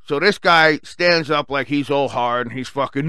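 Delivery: ordinary delivery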